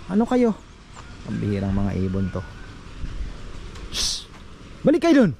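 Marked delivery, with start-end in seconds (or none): none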